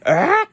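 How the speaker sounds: high squeal of joy, loud; shriek of delight. Acted. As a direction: let out a sudden thrilled squeal. Snoopy sees his parrot.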